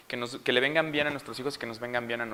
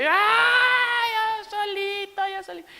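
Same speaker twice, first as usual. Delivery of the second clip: gritos de berrinche; 4 dB louder, much slower than usual. Retold or imitated. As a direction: imitate a screaming toddler insisting. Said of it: "lo dejé ahí y gritando". (chillido agudo imitando un berrinche)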